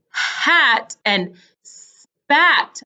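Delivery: HAT with a puffed h, SPAT with a long s; very slow hat and spat; exaggerated onsets. Shown as a demonstration. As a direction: stretch the first sounds of hat and spat. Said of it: "my lips didn't look the same".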